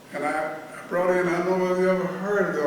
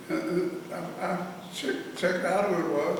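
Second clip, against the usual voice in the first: ordinary talk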